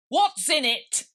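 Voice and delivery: loud, high imperious queen; queen demanding. Acted. Queen demanding to know of the Rabbit, loud and imperious.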